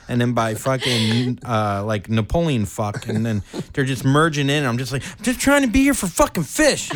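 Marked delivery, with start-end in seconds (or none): 5.2-6.9 high, loud mock whine; exasperated plea. Sandwiched fan pleading to just see Fish, high exasperated protest.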